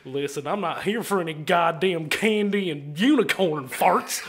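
hokey Texan gripe, breaking into laughter; angry drunk Texan. Acted. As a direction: complain like a riled Texan drunk. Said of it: "Angry, drunk Texan".

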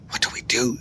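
unvoiced panicked whisper; stammered. A narrator's reading. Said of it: "he stammered".

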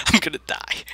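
laughing through the words. Real, not acted. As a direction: giggle it out helplessly. Laughing at own doom.

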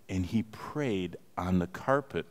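ordinary delivery

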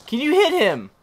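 high, loud question; exasperated yell. Real, not acted. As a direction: call it out loudly at the game. Yelling at the game.

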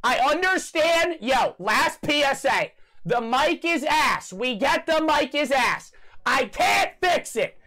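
slow, high, hammered-out words; exasperated announcement. Real, not acted. Streamer's last PSA on the bad mic, exasperated. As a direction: spell it out slowly for people who won't listen.

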